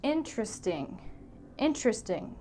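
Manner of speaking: interesting said as IN-trest-ing, the middle vowel gone; three beats, in-trest-ing; three-syllable interesting. Shown as a demonstration. Teacher showing the clipped IN-trest-ing. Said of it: "some Americans will say".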